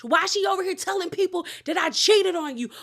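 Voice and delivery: high, fast accusing voice; wife accusing husband behind closed door, high fast anger; mock angry rant. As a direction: fire the questions at him in a high rage. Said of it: "she was going off".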